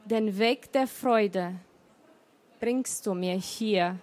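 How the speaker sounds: measured recital with pauses between the lines; poem recital. Acted. Poet reads her poem to the listeners, lightly measured. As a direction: let the refrain "Ich renne gegen die Zeit" carry the poem. Reading announced poems aloud.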